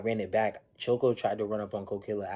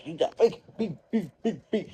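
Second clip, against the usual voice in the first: mimicked bleeps, much higher; beep, beep like a TV censor tone; 9 semitones higher than usual. Narrating a censored reality-show fight, high mock bleeps.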